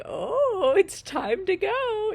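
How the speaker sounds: high, loud, slow mock pig voice; hammed-up pig inner voice; voicing the pig. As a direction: play the pig's excited thoughts. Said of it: "she's like".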